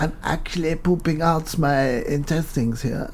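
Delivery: lightly put-on German accent, strained; a suffering German on the toilet. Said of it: "very good German accent".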